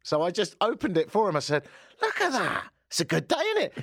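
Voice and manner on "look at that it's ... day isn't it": mock cheery high voice. Mocking the driver in retold scene.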